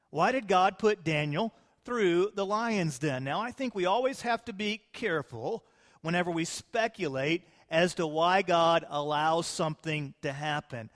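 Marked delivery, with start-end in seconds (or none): none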